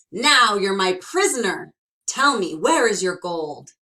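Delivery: loud, gleeful capture cry; triumphant cry. Acted. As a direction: seize him and crow over it. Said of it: "grabbed the sly fellow and cried".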